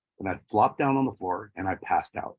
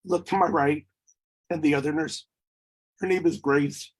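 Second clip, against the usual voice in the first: higher voice, holding back emotion; really moved, pitched up; emotional; 5 semitones higher than usual. Real, not acted. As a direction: struggle to keep composure while recounting. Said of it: "Sorry, people".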